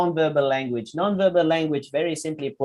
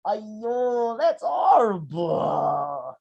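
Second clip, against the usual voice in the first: slow, sorrowful, crying tone; sad voice; 5 semitones higher, much slower than usual. Shown as a demonstration. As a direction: mourn it slowly, sad face. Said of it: "even though your voice is sad".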